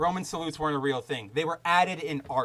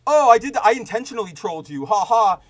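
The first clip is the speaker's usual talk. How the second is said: high, loud mocking troll voice; gloating online troll, mocked; 4 semitones higher, 9 dB louder than usual. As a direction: sneer it like a smug troll. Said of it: "going like".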